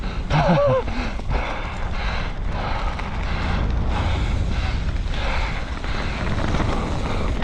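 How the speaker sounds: long breathy laugh. Real, not acted. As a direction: laugh at missing the gap. Can't make the gap.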